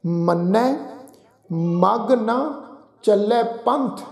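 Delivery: ਮਗ and ਨਾ kept apart, very slowly, with a gap; slow reading that splits ਮਗ ਨਾ into two words; careful recitation. Shown as a demonstration. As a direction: separate ਮਗ from ਨਾ deliberately. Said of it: "ਪੰਕਤੀ ਦਾ ਪਾਠ ਉਚਾਰਨ ਕਰਦੇ ਹਾਂ ਜੀ".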